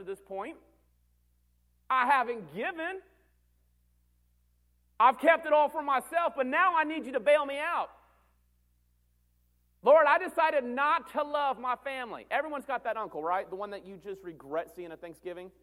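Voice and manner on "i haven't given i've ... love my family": high, forceful shouted confession; acted loud pleading. Believer begging God for a bailout, loud high plea. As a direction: shout it upward in mock desperation.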